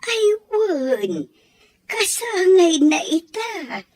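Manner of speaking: touched, voice breaking up high; tearful. Acted. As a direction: choke up at the gifts. Grandmother moved by the birthday gifts.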